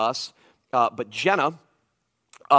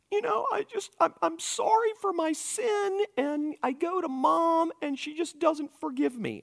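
put-on much higher, pouty girl's voice; high whiny daughter. Dad mimics his daughter complaining about mom, high pouty voice. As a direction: pout it out like a hurt kid.

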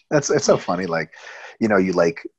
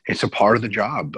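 ordinary talk

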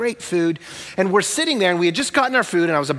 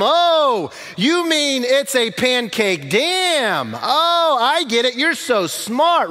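high, drawn-out mock realization; hammed-up loud dad, stretching the words in sudden understanding; even louder; 8 semitones higher, 3 dB louder, much slower than usual. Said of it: "I'm even louder than him this time".

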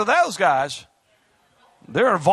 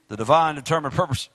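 rushed through at speed; said real fast; 6 semitones lower, faster than usual. Shown as a demonstration. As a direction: rattle it off quickly. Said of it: "I said that real fast".

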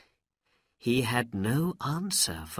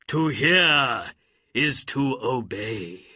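obedient genie; 4 dB louder than usual. A narrator's reading. Jinni accepts the command.

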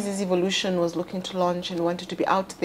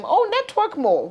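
high, airy, dismissive quip; mock glib advice; 13 semitones higher than usual. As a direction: toss it off like cheap advice. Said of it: "and you're telling them".

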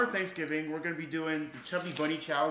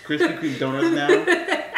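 laughing; 12 semitones higher, 10 dB louder, slower than usual. Real, not acted. Teasing about nickname, laughing.

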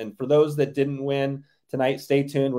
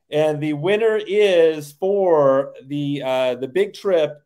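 hyped giveaway announcement; 5 dB louder, much slower than usual. Real, not acted. Drawn-out winner reveal.

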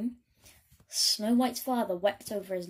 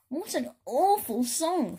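slow, loud and higher, appalled; disgusted; 5 semitones higher, 4 dB louder than usual. Acted. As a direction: groan at the bad song.

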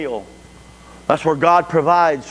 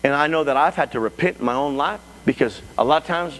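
usual speaking voice

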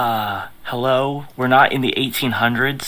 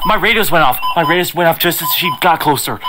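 alarmed; 7 semitones higher, 5 dB louder than usual. Acted. Alarmed by the vampire.

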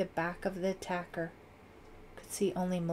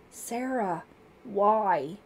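raised, anguished moan; 2 semitones higher, 6 dB louder, much slower than usual.

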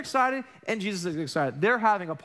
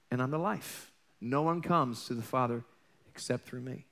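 solemn Jesus; 6 semitones lower than usual. Retold or imitated. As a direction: speak it low and weighty like a promise. Quoting Jesus' answer.

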